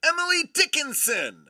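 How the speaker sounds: excited. Real, not acted. Excited card reveal.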